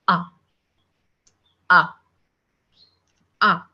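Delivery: "A" said softly and subtly, open ah; "A" as a soft, open French ah; French letter A. Shown as a demonstration. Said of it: "very soft, very subtle".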